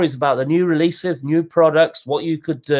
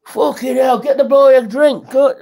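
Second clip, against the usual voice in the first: a loud, rough cheer of surprise, pitched up; delighted shout; 9 semitones higher, 6 dB louder than usual. Retold or imitated. Pub kid recognising the reviewer, shouting in delight. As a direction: bellow it like a pub lad buying a round. Metal kids greet him in pub.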